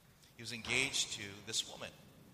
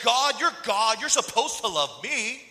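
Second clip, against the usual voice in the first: loud, high mock protest; indignant, insistent outburst; entitled demand; 10 semitones higher, 12 dB louder than usual. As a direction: complain to God as if owed. Acted entitled demand to God.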